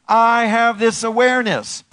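high, loud proclamation; rallying affirmation. Shown as a demonstration. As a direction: call it out for them to repeat. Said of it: "Say it with me".

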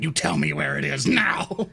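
low, menacing demand; low threat. Acted. Lorne shakes the child and demands, low and menacing. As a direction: growl the order at the child. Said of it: "It's like".

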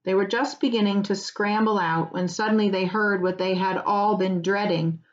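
plain reading voice

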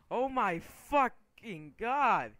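very loud, high, drawn-out scream of rage; explode, stretching every word; real angry shout. Real, not acted. Gamer killed yet again, real angry shout. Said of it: "really me getting fucking angry".

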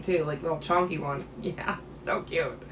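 ordinary talk

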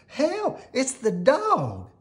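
very high panicked squeak; frightened squeak. A narrator's reading. Melissa spots the dog and squeaks in fright. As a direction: squeak in terror. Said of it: "squeaked Melissa".

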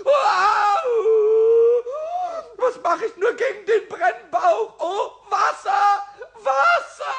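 high, loud panicked yelling; high frantic shouting with a laugh in it; panicked cries for help. Acted. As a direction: shriek for help as the belly burns. Schnapps burning in his belly.

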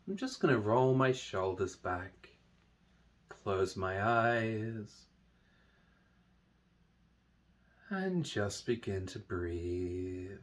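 slow, soft meditation voice with long pauses; guided meditation. Acted. Teacher leading listeners through a body meditation, slow soft guiding voice. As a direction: guide them gently through it, hushed and unhurried.

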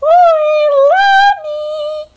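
very high, loud sung call; high singing shout; big yell. A narrator's reading. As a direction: call across the water to her sister. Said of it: "Nani let out a big yell".

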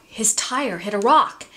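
plain reading voice

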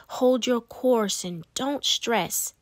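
usual speaking voice

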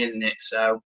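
ordinary talk

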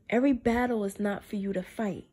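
ordinary talk

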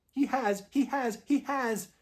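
very high, loud, frantic squeak; squeaky young squirrel. A narrator's reading. Young squirrel loses its head before witch, squeaking frantically. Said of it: "it squeaked".

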